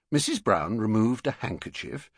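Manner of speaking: ordinary delivery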